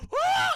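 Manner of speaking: very high, loud shriek; mock scream. Aunt scooped up by vampire, shrieking. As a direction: shriek like a snatched old aunt.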